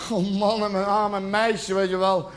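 normal talking voice

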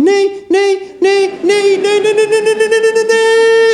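very high, pleading squeal of a car; squeaky falsetto no-no-no; whining car voice. Retold or imitated. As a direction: plead like a scared little machine. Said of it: "dan gaat die auto zo van".

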